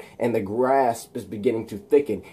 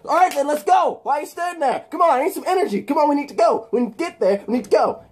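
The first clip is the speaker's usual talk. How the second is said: urgent pushing; 13 semitones higher, faster than usual. Acted. Eddie Brock hurrying the pilot, high and insistent. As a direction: push him hard to get moving.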